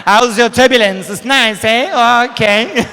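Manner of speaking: loud, stretched-out hammed-up greeting; loud mock greeting. A passenger bellowing a greeting across the curtain, hammed up. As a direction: belt it across the cabin like a show-off. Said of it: "be like".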